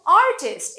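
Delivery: Artist as AR-tist; stress on first syllable. Shown as a demonstration. Teacher recalling her example, artist as AR-tist. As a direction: punch the start of artist. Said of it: "artist এভাবে বললাম".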